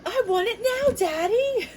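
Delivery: put-on bratty child demanding from daddy; spoiled Veruca voice. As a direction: whine like a spoiled brat. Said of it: "From Willy Wonka".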